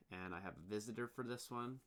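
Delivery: usual speaking voice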